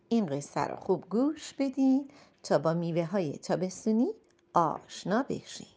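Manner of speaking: usual speaking voice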